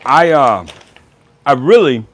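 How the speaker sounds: talking with mouth full. Real, not acted. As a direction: speak around a bite of food. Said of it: "don't talk with your mouth full".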